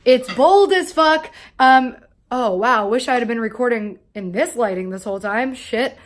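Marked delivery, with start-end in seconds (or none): none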